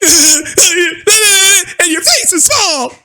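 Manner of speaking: very slow high sob-shouted whine; crying kid voice. Retold or imitated. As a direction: wail it like a bawling child. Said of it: "He went off crying".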